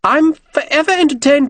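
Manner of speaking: very high-pitched voice. A narrator's reading. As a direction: pronounce each word very distinctly in a high voice. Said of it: "in a very high-pitched voice".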